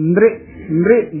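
"ਨ੍ਰਿ" as one short cluster; fuse n and r in "ਨ੍ਰਿ"; nri sound demo. Shown as a demonstration. Teacher shows the sound that should come, short nri. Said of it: "ਦੀ ਆਵਾਜ਼ ਆਉਣੀ ਚਾਹੀਦੀ".

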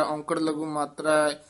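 ordinary delivery

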